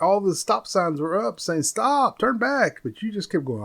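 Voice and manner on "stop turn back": warning call. Retold or imitated. Voicing warning signs.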